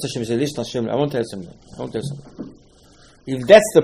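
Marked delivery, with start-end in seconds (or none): 1.8-2.5 a hushed whispered repeat. Retold or imitated. Moshe confiding in Hashem, whispered.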